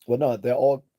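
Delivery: loud, sharp cut-in against the comment; emphatic objection. Real, not acted. Streamer rejecting a chat comment, loud emphatic no. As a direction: shoot the claim down hard.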